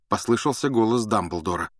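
usual speaking voice

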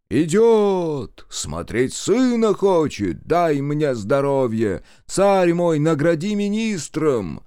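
high, drawn-out calling out; distant cries, pleas. A narrator's reading. Crowd begs favours of its tsar, high calls. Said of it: "слышались далёкие выкрики и просьбы".

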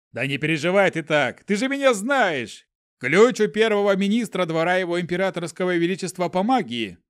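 loud reassurance. A narrator's reading. Mage reassuring loudly.

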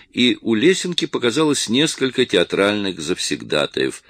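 ordinary delivery

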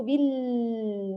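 بال with the l held on and on; over-long lam. Shown as a demonstration. Teacher shows what not to do, lam stretched. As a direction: drag out the final l of بال, "billll". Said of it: "لا تطيل صوت اللام هكذا".

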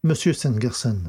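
usual speaking voice

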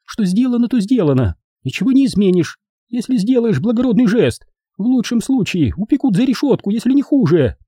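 high falsetto reproach; high-pitched, insistent scolding; lashing out. A narrator's reading. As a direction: argue him down in a high woman's voice. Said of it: "набросилась на Бобби Эдвина".

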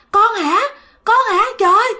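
high-pitched, astonished questions; acted shock, pitch jumping up; startled recognition. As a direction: exclaim as if you suddenly recognise someone. Dead man recognises her, startled.

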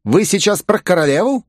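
high, astonished question; surprised. A narrator's reading. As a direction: ask it taken aback. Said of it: "удивился Ято".

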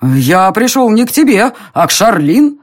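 high, wavering defiance; trembling-voiced shout. A narrator's reading. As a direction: cry out defiantly through nerves. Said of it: "выкрикнул он дрожащим голосом". (дрожащий, высокий выкрик)

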